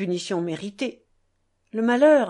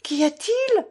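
high, loud frightened call; alarmed cry; 11 semitones higher, 4 dB louder than usual. A narrator's reading. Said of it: "cria Lise, la voix alarmée". (cri alarmé et aigu)

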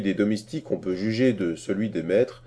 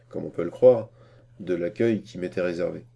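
ordinary delivery

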